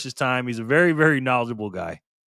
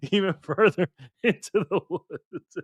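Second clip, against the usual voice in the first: laughing through it; 5 semitones higher, slower than usual. Retold or imitated. Reading a viewer's joke, laughing.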